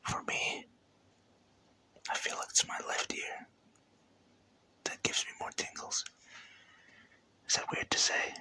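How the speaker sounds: soft unvoiced ASMR whisper, close up. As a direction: whisper each word right into the ear.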